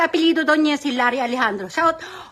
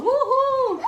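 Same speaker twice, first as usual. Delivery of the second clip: drawn-out woohoo, pitched higher; demo woohoo cheer; 7 semitones higher than usual. Shown as a demonstration. Host shows viewers how to cheer along, drawn-out high woohoo. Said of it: "Kailangan gaganyan-ganyan ka".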